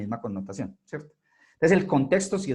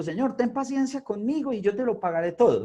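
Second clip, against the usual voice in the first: pleading servant; 9 semitones higher than usual. Acted. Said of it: "Postrado le suplicaba diciendo".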